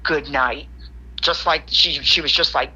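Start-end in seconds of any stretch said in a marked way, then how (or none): 0.0-0.7 low, understated retold farewell; firm mumble. Trembling friend dismissing the man from the couch, firm mumble. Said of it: "mumble out of my mouth in a firm voice".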